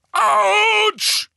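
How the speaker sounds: very high, loud drawn-out ouch; pained yell. A narrator's reading. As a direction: howl from a blow to the nose. Hagrid gets hit on the nose. (lauter Schmerzensschrei)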